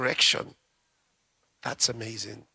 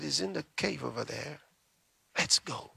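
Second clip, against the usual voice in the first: soft, almost whispered; hushed answer; 7 dB quieter than usual. Acted. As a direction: point the way under the breath. The sisters answer Jesus quietly.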